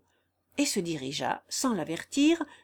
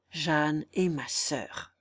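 heavy revelation; 5 semitones lower, much slower than usual. Acted. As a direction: let the truth sink in slowly. Revealing she is his sister.

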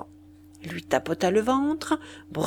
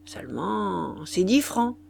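ordinary delivery

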